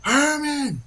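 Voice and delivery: high, far-carrying yell of a brother's name; screaming a name. Retold or imitated. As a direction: call out desperately from down the hill. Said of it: "he's screaming".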